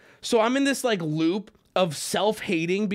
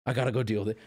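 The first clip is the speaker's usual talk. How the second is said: low muttered pep talk; 11 semitones lower, 5 dB quieter, much faster than usual. Retold or imitated. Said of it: "I'm like".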